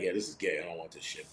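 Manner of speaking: quiet, scornful brush-off, trailing away; dismissive mock. Sneering at mall cops on Segways, muttered disdain. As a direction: wave it off with a sneer under your breath.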